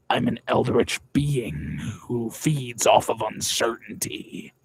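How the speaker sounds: eldritch monster voice. Pretend. Sudden put-on monster line.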